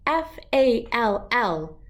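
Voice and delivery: slow letter by letter; spelled out. Shown as a demonstration. Teaching a dog to spell, letter by letter slowly.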